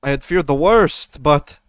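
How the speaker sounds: Venceslav, relieved commander. Acted. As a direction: greet returning heroes you feared were lost.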